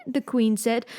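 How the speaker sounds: usual speaking voice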